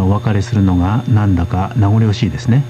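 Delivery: ordinary delivery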